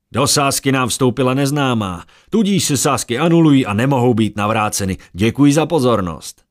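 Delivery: high, loud fairground barker pitching to a crowd; brash huckster calling out bets; carnival barker. Acted. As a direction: drum up the crowd like a showman selling tickets. Errol announces to crowd.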